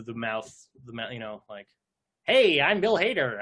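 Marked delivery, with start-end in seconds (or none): 2.2-3.4 higher, louder, slow Bill Hader greeting; a hint of Bill Hader, drawn out; Bill Hader impression. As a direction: stretch the mouth and say it like Bill Hader. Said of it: "I can see the, the mouth".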